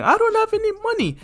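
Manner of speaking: very high, fast squealed protest; high-pitched acted scream, not full volume; desperate screaming. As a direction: cry it out in a frightened kid's falsetto. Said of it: "will continue screaming".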